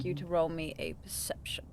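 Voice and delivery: unvoiced whisper. Real, not acted. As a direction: whisper the request secretly. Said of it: "whisper measured".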